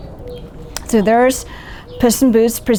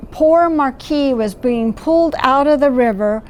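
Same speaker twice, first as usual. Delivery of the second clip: plain reading voice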